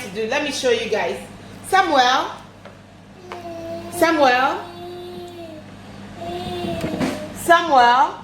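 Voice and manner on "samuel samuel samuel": calling out. Real, not acted. Calling her son across room.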